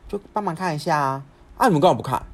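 high, loud retold reproach; exasperated retort. Sister and brother bickering over mum, exasperated retort. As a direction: act out a sibling's exasperated demand. Acted sibling quarrel at station.

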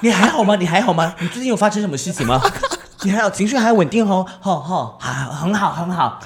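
high, loud, laughing mock concern; mock concerned teacher. Teacher gently mocking an overacting student, high and amused. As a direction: tease the student with fake worry. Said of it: "他真的是做这个表演".

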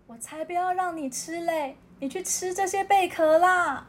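high, teasing little-animal voice; cheeky otter. Acted. Otter taunting the seal while running, high and cheeky. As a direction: taunt him as you run off.

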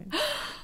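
a high gasp of shock; reliving the moment she touched the eggs, a high gasp; sharp horrified gasp. Retold or imitated. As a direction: suck in air as if touching something vile. Finding roach eggs on collar.

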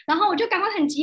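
ordinary delivery

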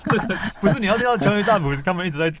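laughing through the words; giggling, barely holding it in; trying not to laugh. Real, not acted. Laughing while retelling courtroom story.

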